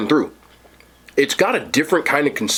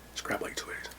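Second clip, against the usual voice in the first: whisper; 19 dB quieter than usual. Real, not acted. Quiet aside while grabbing food.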